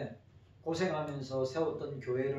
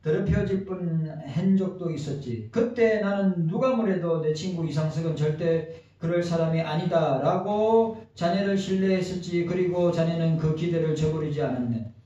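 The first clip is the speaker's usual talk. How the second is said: formal memorial letter read aloud, louder and higher; eulogy reading; 4 semitones higher, 11 dB louder than usual. Acted. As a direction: read out a farewell letter to a late friend, projected and steady. Reads a memorial letter to a friend. (고인이 된 친구에게 바치는 조사 낭독)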